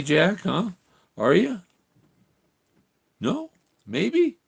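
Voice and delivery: high, rising coaxing voice. Real, not acted. Owner coaxing dog Jack to join the show, high baby talk. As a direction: coax the dog up high and sweet.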